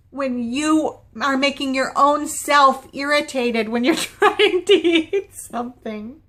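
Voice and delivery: laughing through the words. Real, not acted. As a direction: giggle while you complain. Laughing at own annoyance.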